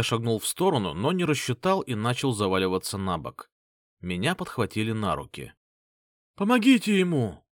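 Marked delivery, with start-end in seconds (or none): 6.3-7.4 loud, high cry for help; shouting. Acted. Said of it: "раздались крики".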